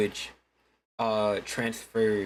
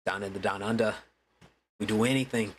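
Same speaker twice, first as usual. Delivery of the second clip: lightly mocked Australian accent; a hint of Australian parody. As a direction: do a caricature Aussie, lightly. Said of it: "People think Australian's just like".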